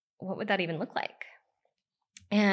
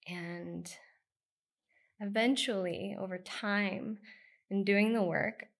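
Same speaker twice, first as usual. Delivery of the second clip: ordinary delivery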